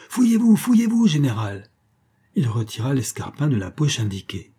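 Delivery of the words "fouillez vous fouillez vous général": high, loud crowd shout, dropping on the last word; crying out. A narrator's reading. Guests order the general to search himself, crying out. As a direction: call out insistently like the onlookers. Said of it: "obéissant au cri".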